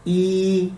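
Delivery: ई said as a level Mandarin yi. Shown as a demonstration. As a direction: say ई like the Chinese number one. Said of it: "ई आम गिनती में बोला जाता है". (चीनी "ई" सीधे सुर में)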